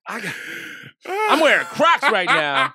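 amused. Real, not acted. Joking, laughing along.

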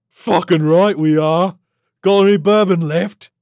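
very loud, high, swaggering alien; brash alien creature. Acted. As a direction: agree boisterously, then cadge a drink.

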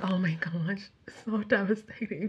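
breathy whispered dismay; hushed gasp. Real, not acted. Vlogger reacting to a sad ending, whispered dismay. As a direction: drop to a whisper, stunned.

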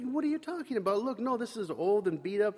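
high, quick mock-innocent protest; defensive denial. Kid insisting to his friend the knife is old. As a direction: play dumb, fast and high, brushing him off. Self denying, arguing with Scott.

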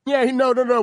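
ordinary delivery

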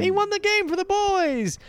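high-pitched slow excited call. Real, not acted. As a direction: celebrate the win. Excited call after game win.

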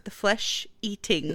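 flesh-eating said slowly, syllable by syllable; slow and clear. Real, not acted. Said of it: "The fleshy what?".

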